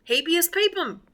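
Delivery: high Texas twang on the Latin; Texas accent. Pretend. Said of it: "How do you like that with my Texas accent?".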